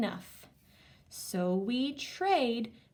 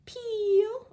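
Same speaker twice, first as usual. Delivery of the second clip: excited; 13 semitones higher, 6 dB louder than usual. Shown as a demonstration. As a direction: crack called out high. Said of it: "now let's do our action words".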